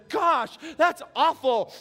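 high, slowed cartoon bunny dismay; Thumper voice. Preacher voicing Thumper's dismay at the owl. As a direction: squeak it out like a shocked little bunny. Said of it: "Thumper responds".

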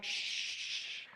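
long breathy shush; drawn-out "shh". Retold or imitated. Hushing the child again.

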